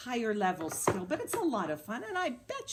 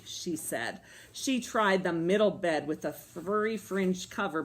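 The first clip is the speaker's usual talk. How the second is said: ordinary delivery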